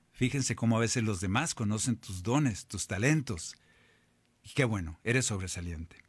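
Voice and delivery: ordinary talk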